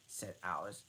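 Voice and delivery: plain reading voice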